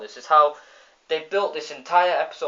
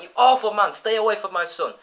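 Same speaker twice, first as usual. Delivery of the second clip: high, loud protective scold; mother warning off a villain; Marge scolding; 7 semitones higher, 5 dB louder than usual. Retold or imitated. Marge shielding Bart from Bob, loud. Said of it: "Marge is like".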